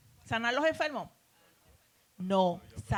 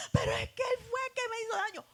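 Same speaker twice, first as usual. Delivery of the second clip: much higher, fast hurt protest; 13 semitones higher, faster than usual. Retold or imitated. Woman protesting to the Spirit, high and fast. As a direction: rush it out, high and wounded.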